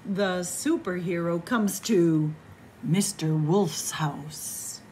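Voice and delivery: ordinary delivery, nothing marked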